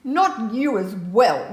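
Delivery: loud, dragged-out storybook protest; loud cry of exasperation; exasperated shout. A narrator's reading. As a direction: shout it slowly, fed up. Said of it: "shouts Nora".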